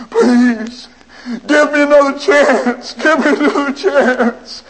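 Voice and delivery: high weeping begging; crying plea. Acted. Prisoner crying while carried to the chair. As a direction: plead as they carry him. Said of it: "he was still crying".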